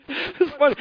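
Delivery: usual speaking voice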